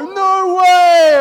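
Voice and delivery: a very high, loud cry of disbelief; stunned "No way!". Retold or imitated. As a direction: shout the punchline at the big reveal. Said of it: "it's like".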